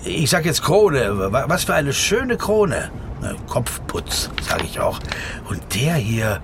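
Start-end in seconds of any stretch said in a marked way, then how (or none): none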